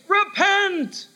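high, ringing call to the helmsman; a sea officer calling out a course change; navigator's call. Retold or imitated. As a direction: sing out the order across the deck. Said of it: "So the navigator would say".

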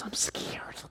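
tiny frightened whisper; scared little boy. Retold or imitated. Boy to his seatmate during take-off, scared whisper. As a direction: whisper it like a nervous child confessing. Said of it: "He goes".

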